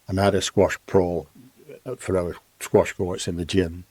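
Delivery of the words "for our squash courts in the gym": ordinary talk, nothing marked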